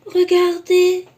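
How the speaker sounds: high, loud, slow amazed cub; Baby Bear finding Goldilocks, astonished. Acted. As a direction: gasp it out in wonder. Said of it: "s'exclama Bébé Ours étonné".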